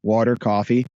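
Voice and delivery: "coffee" said the New Jersey way, with an open "caw" vowel; broad Jersey "Water, coffee", leaning into the accent; New Jersey "cawfee" in "Water, coffee". Shown as a demonstration. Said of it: "You can hear my Jersey accent".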